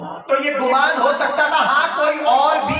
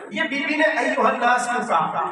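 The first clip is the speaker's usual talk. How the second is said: usual speaking voice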